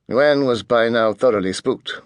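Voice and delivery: usual speaking voice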